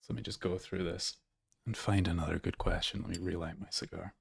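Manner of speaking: low, soft talking to oneself; quiet aside. Real, not acted. Host looking for another question, quiet aside. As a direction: murmur it softly while busy with something.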